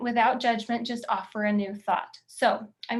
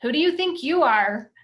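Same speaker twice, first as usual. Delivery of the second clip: louder, sharp accusing critic voice; mock inner critic jab; 4 semitones higher, 7 dB louder than usual. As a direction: throw the critic's put-down back at them. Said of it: "When she says".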